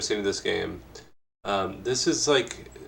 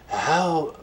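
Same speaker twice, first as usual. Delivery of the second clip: higher, drawn-out opening word in a put-on voice; Tim Rogers impression; 7 semitones higher than usual. Interviewer starts his next question as an impression. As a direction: attempt an impression, starting high. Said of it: "I'll do it in the Tim Rogers voice".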